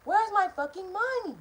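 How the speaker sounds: high-pitched mock yell, not full volume; acted-out angry demand; retold yelling. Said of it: "so I started yelling".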